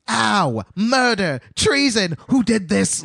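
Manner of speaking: high, loud indignant screams; mock screaming. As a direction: shriek like a wounded tyrant king. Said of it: "he's just screaming with his little fat body".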